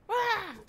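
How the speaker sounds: high, loud "ah"; startled yelp. Real, not acted. Gamer caught out mid-climb, startled yelp. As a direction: yelp suddenly.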